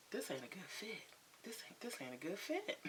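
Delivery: very soft, under-the-breath verdict; hushed acted-out realization; quiet aside. Acts out sitting back, judging.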